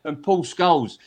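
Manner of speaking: ordinary talk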